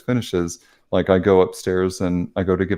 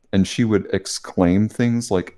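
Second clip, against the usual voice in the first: ordinary delivery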